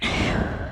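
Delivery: a long, breathless exhale; heavy sigh. Real, not acted. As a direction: sigh out hard, winded. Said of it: "Sorry, I'm out of breath".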